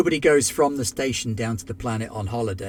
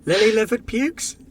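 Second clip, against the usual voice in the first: shouted; 10 semitones higher, 6 dB louder than usual. Acted. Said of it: "full Hondo".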